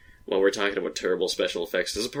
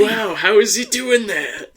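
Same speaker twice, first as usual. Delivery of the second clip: mock amazed onlooker; 11 semitones higher, 6 dB louder, slower than usual. Mocking a fake jump scene.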